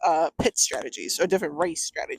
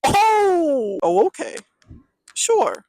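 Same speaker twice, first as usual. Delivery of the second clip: high, loud mock realization easing off; sarcastic concession; 10 semitones higher, 4 dB louder, much slower than usual. Real, not acted. Critic giving in to fans of the scene, sarcastic. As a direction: pretend to give in, pitched up and sarcastic.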